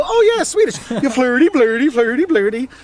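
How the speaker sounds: high, sing-song mock Swedish gibberish; hammed-up Swedish Chef babble; Swedish Chef impression. Tourist mimicking the Swedish Chef at locals, hammed-up impression. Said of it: "chef impression".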